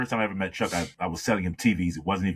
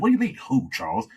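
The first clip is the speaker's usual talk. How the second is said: higher, louder, incredulous coworker; mock disbelief; 11 semitones higher, 3 dB louder, faster than usual. Coworker in disbelief that Charles doesn't know Chuck, high incredulous. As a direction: act shocked he's never heard of him.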